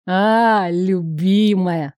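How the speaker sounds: put-on slow drunken greeting. A narrator's reading. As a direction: slur it like a drunk. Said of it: "Бернард пьяно икнул".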